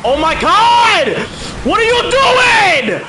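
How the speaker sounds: real high, drawn-out scream. Real, not acted. Player yelling at a rival mid-race, screaming. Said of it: "I'm sorry for screaming".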